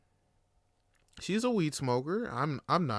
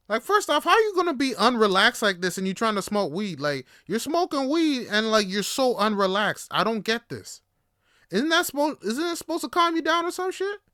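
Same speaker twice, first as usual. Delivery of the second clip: high, loud, indignant questioning; acting out a worked-up protest at his date; exasperated rant; 7 semitones higher, 6 dB louder than usual. Asking his date why weed hasn't calmed her, exasperated.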